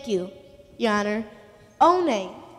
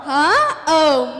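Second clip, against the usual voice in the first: startled; 2 semitones lower, 8 dB louder than usual. Acted. Startled pig called on.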